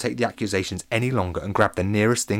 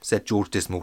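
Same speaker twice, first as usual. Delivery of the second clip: ordinary delivery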